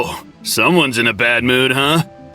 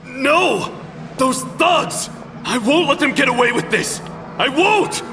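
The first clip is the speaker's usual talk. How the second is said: high-pitched, desperate anger; short-fused outburst; 10 semitones higher than usual. Acted. Man's subconscious rages at thugs, short-fused anger. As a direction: cry out in furious refusal. Seems to have a short fuse.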